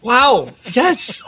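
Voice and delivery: high, overjoyed squeals; gushing excitement. Acted. Passenger thrilled to get a New York cab, gushing. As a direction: gush like a thrilled newcomer.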